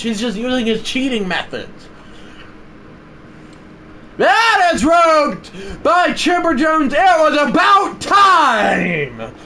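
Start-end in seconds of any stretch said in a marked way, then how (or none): 4.2-9.3 high, loud, slow relieved yell; excited shout. Real, not acted. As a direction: shout it like it's finally happened. Big hit finally comes.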